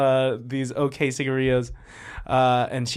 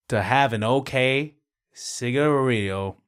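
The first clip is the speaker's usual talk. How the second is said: ordinary talk, nothing marked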